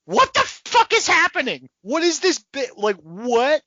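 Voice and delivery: very high, loud disbelieving cry; high loud mock bewilderment; baffled outcry. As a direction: shriek in total confusion. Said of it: "we're just both like".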